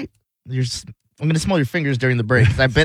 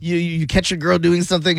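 normal talking voice

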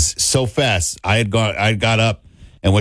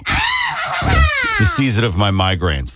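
mock witch voice; slower than usual. Cohost mocks witch voice.

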